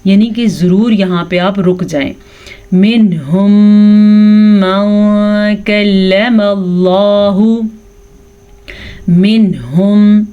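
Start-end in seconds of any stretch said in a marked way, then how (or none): 2.7-10.2 louder, slow tajweed recitation; Quran recitation. Shown as a demonstration. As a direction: recite with drawn vowels. Recites the verse.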